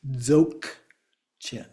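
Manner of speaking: "dzogchen" said as DZOK-chen, split at the K; G pronounced as K. Shown as a demonstration. Teacher showing a variant, "dzogchen" with K in the middle. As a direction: close the first half of "dzogchen" on a K stop. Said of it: "or a K. So sometimes it's actually pronounced as".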